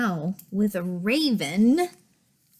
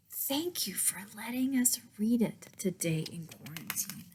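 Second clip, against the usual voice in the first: soft whisper, a bit quick; whispered thanks; 8 dB quieter, faster than usual. Shown as a demonstration. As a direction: breathe the thanks like a secret.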